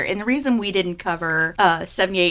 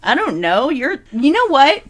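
normal talking voice

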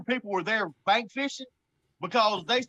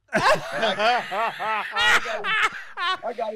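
laughing; 2 semitones higher, 4 dB louder, much slower than usual. Real, not acted. Guest laughing as he tells the host he got him. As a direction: laugh it out as you brag. Laughing at his own win.

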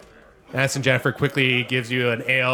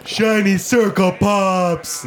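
slow, louder dim-witted delight; Small Fry; 6 semitones higher, 4 dB louder, slower than usual. Acted. Small Fry chasing bubbles, slow and delighted. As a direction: stretch it out like an excited simpleton. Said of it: "And Small Fry goes".

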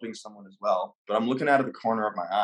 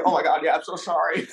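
panicked, higher-pitched apology, acted out; shaking apology; 5 semitones higher than usual. Rattled popcorn worker apologizes to the star, mock panic. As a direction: imitate a rattled worker blurting sorry. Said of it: "he's just shaking. He's just like".

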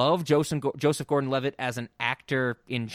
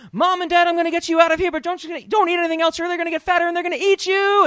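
put-on much higher, louder, rushed little-girl plea; Chihiro's panicked line; 7 dB louder, faster than usual. Podcast host imitating Chihiro crying to her parents, high and frantic. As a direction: mimic a frantic child begging her parents. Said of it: "that wonderful line that Devay Chase delivers where she goes".

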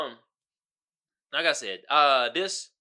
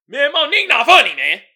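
high, loud scoffing dismissal; dismissive hater; 10 semitones higher, 15 dB louder than usual. Retold or imitated. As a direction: brush her off mockingly. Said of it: "some people would be like".

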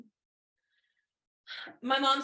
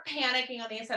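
ordinary talk

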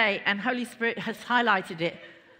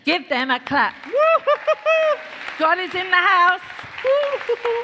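loud, high-pitched whooping celebration; excited cheering; 10 semitones higher, 10 dB louder than usual. Real, not acted. Preacher rousing the church to clap, excited loud whoops. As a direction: whoop it up at full volume. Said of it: "I'm so excited".

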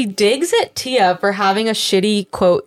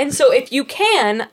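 usual speaking voice

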